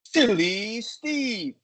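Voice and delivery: high, loud, drawn-out silly call; hammed-up silliness. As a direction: sing it out goofily, swooping down on Steve. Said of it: "passing the emotion that goes to it".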